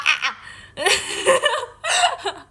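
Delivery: helpless squeal of laughter; high squealing laugh. Real, not acted. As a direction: burst into high, loud laughter. Laughing after her shriek. (tawa melengking)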